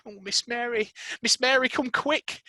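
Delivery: high urgent pleading; begging maid. Acted. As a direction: plead with her to hurry. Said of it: "she begged".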